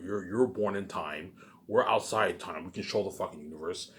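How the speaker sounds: ordinary talk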